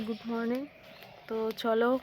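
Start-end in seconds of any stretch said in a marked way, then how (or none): 0.1-0.6 talking through a toothbrush; words round a toothbrush, mouth busy; while brushing teeth. Real, not acted. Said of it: "তো brush করতে করতে".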